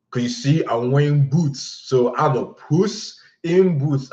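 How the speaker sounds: put-on high, slow proud drunk girl; lightly voiced drunk girl. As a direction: stretch out the pun pleased with yourself. Drunk girl voiced explaining joke.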